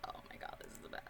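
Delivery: excited whisper; hushed, thrilled whisper. Retold or imitated. Retold delight at uncensored song.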